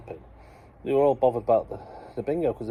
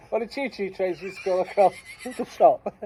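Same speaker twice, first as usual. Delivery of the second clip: laughing while talking; 6 semitones higher, 3 dB louder than usual. Real, not acted. Retelling through laughter.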